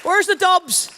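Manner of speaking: high, slow, loud welcome; loud crowd greeting. Real, not acted. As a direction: shout it out to the whole hall.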